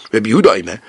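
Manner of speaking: usual speaking voice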